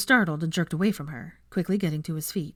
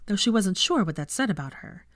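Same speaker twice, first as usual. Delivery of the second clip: usual speaking voice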